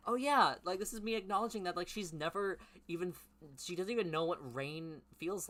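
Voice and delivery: high loud mock exclamation; mocking outburst. Real, not acted. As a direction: scoff loudly at the story. Mocking the character.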